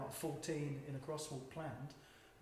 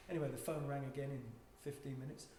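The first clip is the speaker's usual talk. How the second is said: usual speaking voice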